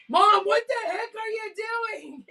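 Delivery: put-on high shocked teen outburst; like a kid catching mom. Daughter walks in shocked.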